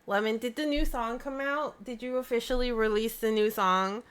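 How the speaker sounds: ordinary delivery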